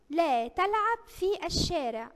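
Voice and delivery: slow example reading. Shown as a demonstration. Teacher reads example slowly.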